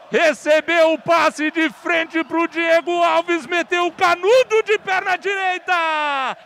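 high, surging excited replay call; explosive goal commentary. Real, not acted. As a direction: ride the wave of the goal. Replay description right after goal.

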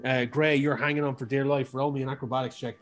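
ordinary delivery

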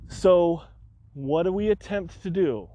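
ordinary talk

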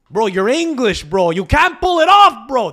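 loud, high scoffing outburst; Montreal attitude. Acted. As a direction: call them out like an indignant Montrealer. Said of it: "The Montreal attitude is".

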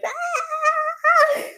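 high, laughing fan scream; excited scream. Retold or imitated. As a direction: squeal like a fan at the front. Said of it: "I was so like".

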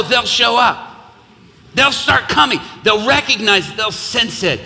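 fiery revival preaching; full-force preaching. Real, not acted. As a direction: drive it home to the congregation.

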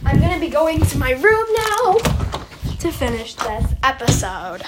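loud, sustained call rising at the end; loud announcement. Pretend. Vlogger announcing a move to their room, loud announcement. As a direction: belt it out, climbing to the last word.

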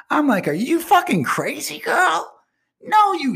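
high, loud disbelieving shriek; how can you even ask that; incredulous outburst. Retold or imitated. To the abused girl, a high loud shriek of disbelief.

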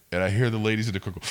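usual speaking voice

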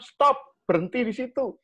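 put-on high, loud command to halt a running child; firm stop command. Parent stopping a running child with a firm call. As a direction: call it out short and clear. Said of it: "Mbok langsung bilang".

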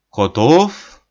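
резко и громко спросить; выкрикнуть вопрос коротко; громкий вопрос. A narrator's reading. Немец спрашивает. (громко спрашивает)

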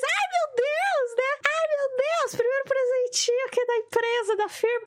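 high, squealing delight; mock giddy joy. Herself getting the company gift, high giddy squeal. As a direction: squeal like you just got a gift. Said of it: "E eu toda feliz".

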